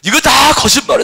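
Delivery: firm loud rebuttal; loud declaration. Acted. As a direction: proclaim the lie boldly.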